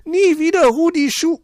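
very high, loud shriek cut off; shrieking again. Acted. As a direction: shriek until silenced. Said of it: "die Dicke erneut loslegt". (kreischend, abgebrochen)

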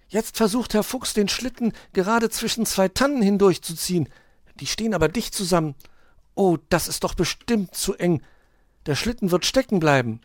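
put-on high, loud live commentary in a child figure's voice; young onlooker Borstel reporting. A narrator's reading. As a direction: report eagerly what you spot. Borstel reports through the telescope. (aufgeregter kleiner Beobachter, hoch und laut)

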